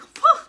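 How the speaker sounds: high, loud puff of relief; amazed phew. Retold or imitated. Recalling news of the shorter fast, amazed phew. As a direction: blow out a sudden phew.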